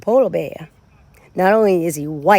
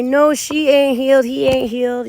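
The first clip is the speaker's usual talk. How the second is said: higher, louder scoffing mock quote; mocking naysayers; 4 semitones higher, 5 dB louder than usual. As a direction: sneer it like the doubters. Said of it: "They say".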